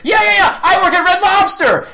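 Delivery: high, loud eager yeahs; enthusiastic guy. Retold or imitated. Comic describing heckler's eager yeahs. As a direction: gush like an overexcited fan. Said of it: "he goes".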